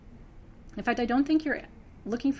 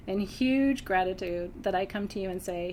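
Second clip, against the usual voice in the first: ordinary talk, nothing marked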